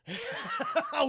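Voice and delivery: high chuckling laugh. Real, not acted. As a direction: laugh knowingly at what is coming. Sarcastic laugh about LAPD.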